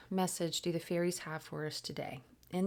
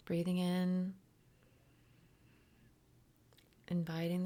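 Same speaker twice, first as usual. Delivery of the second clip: guided meditation. Acted. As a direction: guide the breath gently, letting words settle. Leads a breathing meditation.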